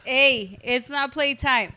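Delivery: high, firm little telling-off to the pups; scolding the dogs. Real, not acted. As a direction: stop the dogs from playing mid-podcast. Scolding dogs during recording.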